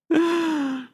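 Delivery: a burst of high, bright laughter. Real, not acted. Host laughs at chat comment.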